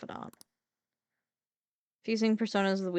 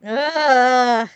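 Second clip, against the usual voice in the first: loud, drawn-out ugh; loud disgusted groan; 8 dB louder than usual. Real, not acted. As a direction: groan like you're sick of it. Groan at game character.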